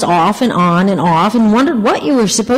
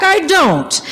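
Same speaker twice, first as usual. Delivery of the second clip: high, called over the noise; mock shout; 9 semitones higher than usual. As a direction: shout it back lightly. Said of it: "I shouted back".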